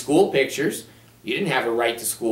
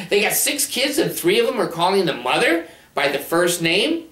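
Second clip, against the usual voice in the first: usual speaking voice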